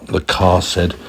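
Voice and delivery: ordinary delivery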